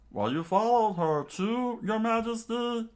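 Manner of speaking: high, loud, incredulous question; astonished outcry. Acted. Servant catching the king following her too, astonished. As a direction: voice leaping up in disbelief. Dads shocked to meet each other.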